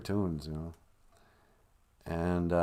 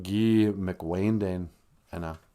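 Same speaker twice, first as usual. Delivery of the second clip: "Guy McWayne Dane Enna" slowly, in separate pieces; slow teaching breakdown of "Guy McWayne Dane Enna"; word by word; 3 semitones lower, slower than usual. Shown as a demonstration. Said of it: "Or you can just smush it together".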